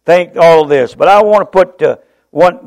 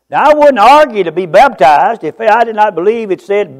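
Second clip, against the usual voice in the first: ordinary talk, nothing marked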